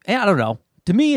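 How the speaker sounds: ordinary talk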